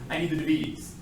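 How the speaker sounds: "D V Ds" as de-VEE-dees, stress on the V; oddly accented "D V Ds", weight on V; wrong-syllable stress. Shown as a demonstration. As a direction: hit the middle letter of "D V Ds". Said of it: "I changed the emphasis on the different syllable".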